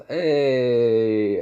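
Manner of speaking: loud, long stretched hey; drawn-out call. Acted. Trombone player walking away, calling out a long hey. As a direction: hold the hey out loudly.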